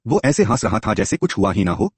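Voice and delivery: ordinary delivery, nothing marked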